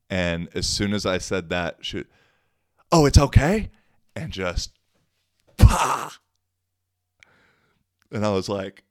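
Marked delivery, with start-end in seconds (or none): 2.9-3.7 high, loud incredulous retort; retold indignant flare-up. Retold woman flaring up before punch. 5.6-6.1 loud, high impact sound; imitated punch noise.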